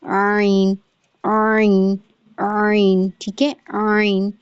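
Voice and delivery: عین with a full squeezed mid-throat onset; عین strained from the middle throat; full ain. Shown as a demonstration. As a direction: say the whole عین, wrung and pushed inward. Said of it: "پھر آپ پورا عین بولیں گی".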